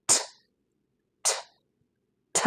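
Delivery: "ঠ" as a tiny voiceless aspirated tick; isolated T sound. Shown as a demonstration. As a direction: release each "ঠ" with a puff, no voice. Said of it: "এর sound হলো". (স্বর ছাড়া ছোট "ঠ" ধ্বনি)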